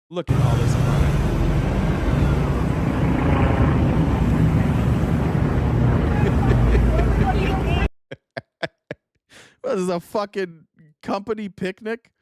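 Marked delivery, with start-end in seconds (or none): 1.1-7.9 loud cackle. Real, not acted. Laughing at crime footage. 9.6-12.1 laughing incredulous. Real, not acted. Mocking footage, laughing.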